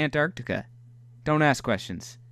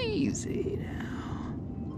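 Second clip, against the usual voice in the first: slow hushed breathy easy now; teasing the submarine into the hole, whispering; whispered coaxing; 10 dB quieter, much slower than usual. Pretend. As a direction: soothe it in a whisper.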